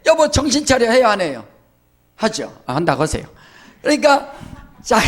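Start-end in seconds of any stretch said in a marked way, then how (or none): 0.0-0.9 higher mock nag; husband scolding wife. Pastor imitates a husband nagging his wife, higher. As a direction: scold her like a fed-up husband. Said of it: "또 남편도 아내한테".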